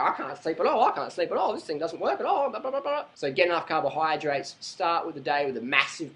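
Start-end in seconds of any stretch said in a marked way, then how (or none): 0.0-3.0 rising whine, getting higher; mock the moaning sleeper; whiny complainer. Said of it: "So you're laying there just like".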